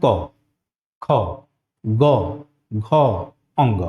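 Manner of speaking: each velar consonant said slowly and separately; क ख ग घ अंग, each from the throat with a pause; articulated velar consonants. Shown as a demonstration. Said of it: "जैसे बोलते हैं". (हर व्यंजन कंठ से अलग-अलग, धीरे बोलें)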